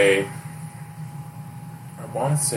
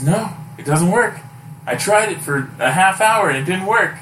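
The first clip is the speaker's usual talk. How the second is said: insistent; 8 semitones higher, 4 dB louder than usual. Retold or imitated. Jorge insists key fails.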